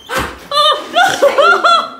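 startled scream breaking into laughter; scream into giggles. Real, not acted. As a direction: shriek then giggle. Dare to open creepy door.

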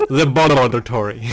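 "laboratory" with a rolled, babbled middle. Shown as a demonstration. Showing co-player how to say "laboratory", middle rolled. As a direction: roll the middle of "laboratory" like blah-blah-blah. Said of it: "you gotta roll it".

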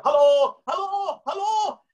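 screaming into phone. Retold or imitated. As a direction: yell it down the line. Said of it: "He screamed into the phone".

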